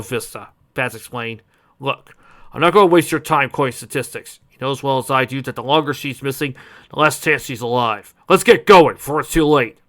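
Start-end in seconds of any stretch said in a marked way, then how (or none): 1.8-9.8 urgent, firm cop talking fast; gruff detective voice. Acted. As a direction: push the search forward. Detective character voice.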